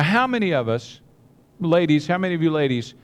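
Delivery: ordinary talk, nothing marked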